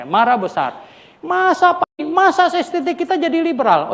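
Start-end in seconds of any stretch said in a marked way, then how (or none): 1.2-3.9 high, indignant squeal of disbelief; mock outraged protest. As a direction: play the upset colleague, pitched well up. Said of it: "Mereka marah besar sama saya". (protes kesal yang ditirukan, nada tinggi)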